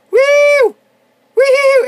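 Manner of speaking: very high, loud whoops; triumphant whooping. Real, not acted. Gamer celebrating getting the enemy, triumphant whooping.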